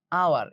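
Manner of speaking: আওয়ার opening on the vowel, h left off; silent h. Shown as a demonstration. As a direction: pronounce hour as "ow-er", আওয়ার. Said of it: "উচ্চারণ কি হয়েছে?". ("হ" বাদ দিয়ে আওয়ার উচ্চারণ)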